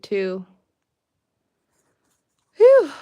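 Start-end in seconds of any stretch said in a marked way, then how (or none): 2.6-2.9 sharp high whew of effort; exhaled whew. Real, not acted. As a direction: blow out a loud whew. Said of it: "getting a little workout here".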